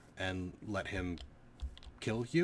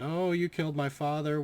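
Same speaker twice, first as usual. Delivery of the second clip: pitched-up mock whine of the girl; hammed-up sobbing accusation, higher; mocking whiny girl; 8 semitones higher than usual. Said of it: "she immediately cried".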